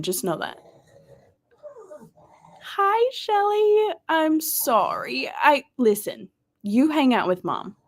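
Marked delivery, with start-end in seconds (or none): 2.6-5.3 high, slow, drawn-out hello; high stretched welcome and apology; sing-song greeting. Real, not acted. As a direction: coo the name with delight. Greeting a viewer in chat.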